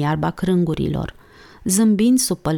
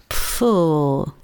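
short snort of laughter through the lips; two girls snort at the boy; snorting pfu; 2 semitones lower than usual. A narrator's reading. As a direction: puff out a stifled giggle. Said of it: "pufniră amândouă".

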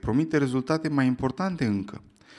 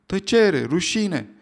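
higher, louder shout, then falling; 6 semitones higher, 6 dB louder, much slower than usual. Acted. Other students shout back at the hecklers, loud. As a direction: shout them down. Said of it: "răspundea strigând".

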